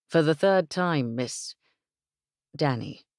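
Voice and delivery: a hint of a proper English gentleman, patient; lightly voiced Englishman. Englishman correcting the rancher's daughter, mildly voiced gentleman. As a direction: gently insist on the proper title. Evan corrects her form of address.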